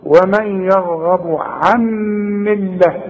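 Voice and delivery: ومن يرغب عن ملة with the nun of عن merged into the meem, said slowly; عن ملة with a slow nasal merge. Shown as a demonstration. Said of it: "بتضغم في الميم".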